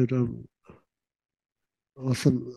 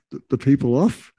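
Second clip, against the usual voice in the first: ordinary talk, nothing marked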